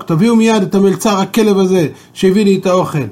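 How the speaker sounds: furious king yelling for the waiter; king shouting. Acted. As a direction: bellow the order at the servants. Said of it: "המלך התחיל לצעוק".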